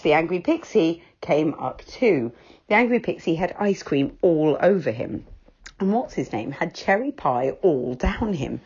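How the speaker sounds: ordinary delivery, nothing marked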